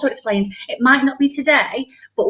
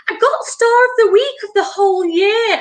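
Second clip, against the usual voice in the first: put-on much higher, louder thrilled child; excited child; 10 semitones higher, 3 dB louder than usual. Daughter telling mum her school news, excited. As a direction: burst out proud and thrilled. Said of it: "She's like".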